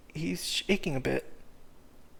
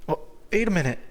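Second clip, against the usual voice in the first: alarmed protest; 4 semitones higher, 6 dB louder than usual. Acted. Cyrus protesting being pulled.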